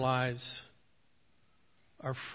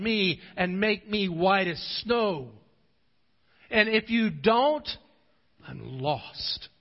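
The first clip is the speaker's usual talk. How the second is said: raised, slow, pleading confession; David's confessing prayer; 4 semitones higher, 4 dB louder, slower than usual. Acted. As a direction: pour out the heart in slow, broken pleas. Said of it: "He wasn't afraid to say".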